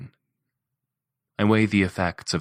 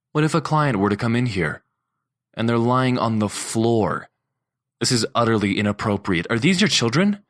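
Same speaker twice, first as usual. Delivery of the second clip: laying into her; 5 semitones higher, 4 dB louder than usual. A narrator's reading. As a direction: rise in fury to the last question. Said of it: "I'm laying into her".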